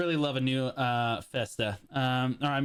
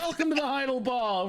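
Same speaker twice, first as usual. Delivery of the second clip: announcer voice; 9 semitones higher than usual. Retold or imitated. Mocking the ball's announcer.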